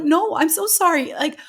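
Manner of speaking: high, loud, flustered self-mocking apology; embarrassed apology. American visitor to an Irishman she can't understand, embarrassed apology. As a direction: retell a mortified apology, pitch leaping right up. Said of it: "but I was more embarrassed".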